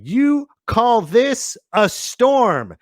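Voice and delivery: slow, high dramatic quote; movie quote. Acted. Host reading quote of the day, dramatic. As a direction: declaim it slowly, word by word. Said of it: "Quote of the day".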